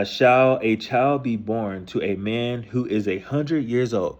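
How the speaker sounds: slow, deliberate reading of the verse; slow reverent verse reading; scripture reading. Acted. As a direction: read the Bible line slowly and carefully. Reads the verse aloud.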